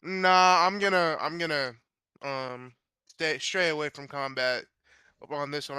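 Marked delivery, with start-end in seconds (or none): none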